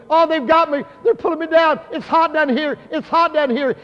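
high, loud cries of a man being dragged under; screaming retelling. Retold or imitated. Dying brother screaming from his hospital bed, retold as high panicked cries. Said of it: "My brother was screaming".

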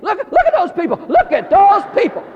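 very high, loud squealed excitement; shouting kids. Retold or imitated. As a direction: imitate thrilled kids hollering from the back seat. Kids gawking from back seat.

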